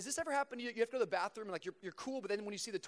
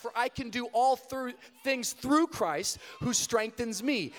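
louder, slow preaching climax; building preaching; 9 dB louder than usual. Acted. Preacher closes by urging the crowd, louder and slow. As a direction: drive it home, slow and strong.

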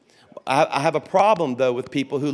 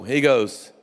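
ordinary delivery

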